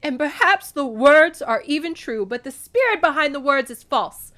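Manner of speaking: high, loud denunciation; angry Demeter. A narrator's reading. Demeter rejects Zeus's word, angry. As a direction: denounce him at full voice.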